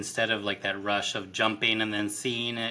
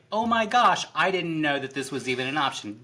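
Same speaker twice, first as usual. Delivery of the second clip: high, loud fake shock, then rushed; mock surprise; 4 semitones higher, 3 dB louder, faster than usual. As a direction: gasp it like feigned astonishment. Mocking her feigned surprise on show.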